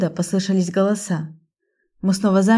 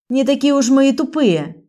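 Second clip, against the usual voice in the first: angry shout; 6 semitones higher than usual. Acted. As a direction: jump up and yell it. Lika jumps up with knife.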